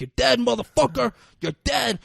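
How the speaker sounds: high, loud acted yelling; furious threat. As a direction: shout it while fighting to win. Acted death threat during fight.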